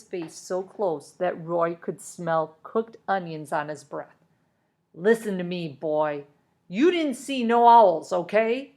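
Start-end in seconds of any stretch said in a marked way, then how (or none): 4.9-8.7 threatening foreman. A narrator's reading. Foreman intimidating the boy.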